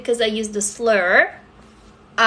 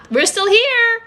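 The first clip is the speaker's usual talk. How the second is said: delighted surprise; 10 semitones higher, 4 dB louder than usual. Real, not acted. Streamer thrilled to viewers the swearing passed, gleeful high exclamation. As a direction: pitch jumping way up, thrilled to be still live. Testing swear, still live.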